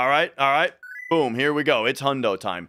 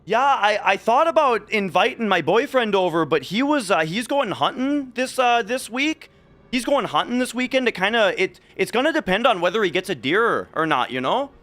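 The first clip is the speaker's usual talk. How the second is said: put-on higher, chatty rural Minnesota accent; 7 semitones higher than usual. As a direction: mimic a Minnesota relative, pitched up. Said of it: "that's how the family talks".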